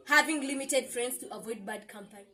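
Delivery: normal talking voice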